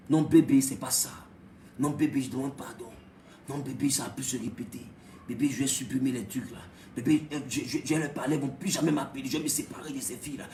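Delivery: low, soft begging to his wife; low, soft apology to an angry wife; pleading husband. Acted. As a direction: grovel quietly like a caught husband.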